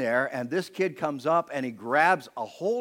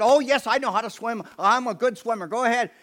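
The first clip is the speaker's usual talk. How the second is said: put-on much higher, louder confident kid voice; cocky kid; 10 semitones higher, 6 dB louder than usual. Preacher voicing the boy insisting he can swim, high and eager. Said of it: "And he said".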